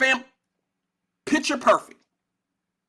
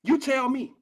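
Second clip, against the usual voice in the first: normal talking voice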